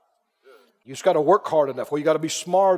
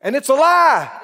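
loud declaration; 7 semitones higher, 6 dB louder than usual. Acted. Preacher denouncing false teaching, loud firm declaration. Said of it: "I said".

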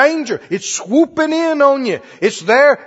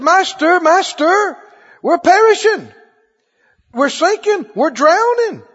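higher-pitched, acted cry for help; mock alarmed cry; 7 semitones higher than usual. Disciples waking their master in the storm, mock alarm. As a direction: call out like men going under, half in earnest. Disciples crying out in sinking boat.

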